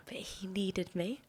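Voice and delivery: hushed conspiratorial whisper; gossiping in a whisper to the listeners. Real, not acted. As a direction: lean in and whisper it. Whispered aside in story.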